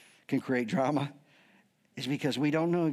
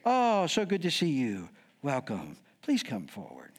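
luring welcome; 5 semitones lower than usual. Acted. Paul drawing them in before a smack, falsely sweet. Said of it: "Let's draw them in".

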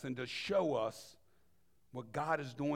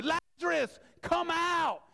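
higher, louder command to come out; commanding call; 13 semitones higher, 5 dB louder, slower than usual. Acted. Jesus calling the dead man out of the tomb, loud and high. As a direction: call him out of the grave.

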